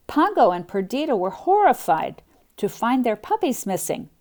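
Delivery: usual speaking voice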